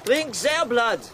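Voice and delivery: excited, high-pitched pressing of a mad idea; fervent urging. Acted. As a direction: urge them on with rising fervour. Urging that they drink gulls' blood.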